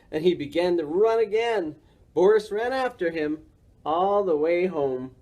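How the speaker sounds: usual speaking voice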